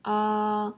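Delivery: "Ah" held level on a high pitch; "Ah" in the Chinese first tone, flat and high. Shown as a demonstration. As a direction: sing "Ah" steady and high like a held note. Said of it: "a flat high-pitched tone".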